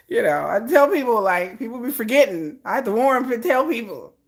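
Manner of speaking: ordinary delivery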